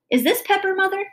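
put-on high, childlike question; innocent child. A narrator's reading. Daughter holding pepper up to mother, innocent child voice. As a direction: pipe up like a curious little kid.

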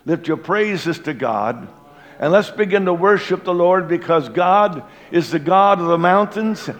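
loud fervent call to worship; preaching. Acted. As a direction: lift the room into praise. Calls congregation to worship.

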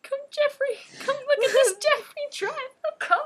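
high quoted voice. Pretend. Quoting video voice.

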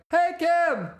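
high, cheery neighborly shout; friendly neighbor calling out. Pretend. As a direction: call out like a chummy neighbor. Greeting Kim in the street.

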